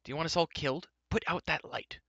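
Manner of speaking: high, urgent scolding at normal volume; tense, alarmed order; alarmed cry. A narrator's reading. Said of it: "he cried".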